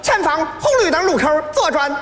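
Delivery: high-pitched Henan-dialect navigation voice; Henan accent. Retold or imitated. Comic mimics celebrity sat-nav giving directions, Henan accent. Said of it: "河南语音的".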